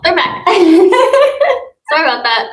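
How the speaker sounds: giggling through the words; breaking into laughter; laughing. Real, not acted. Teacher laughing at interruption.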